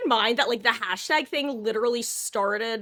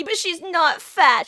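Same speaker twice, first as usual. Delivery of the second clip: loud, high, stretched mock protest; joking indignant defense; 8 semitones higher, 7 dB louder, slower than usual. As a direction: insist with hammed-up outrage. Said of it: "I joke with the way".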